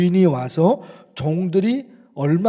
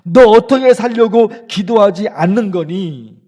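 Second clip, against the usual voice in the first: scolding rebuke; 5 semitones higher, 10 dB louder than usual. Acted. Jesus rebuking disciples.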